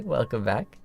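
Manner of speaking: ordinary talk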